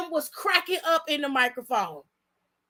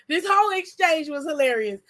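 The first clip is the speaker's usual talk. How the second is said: ordinary talk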